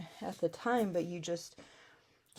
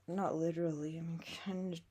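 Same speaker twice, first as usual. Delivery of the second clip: usual speaking voice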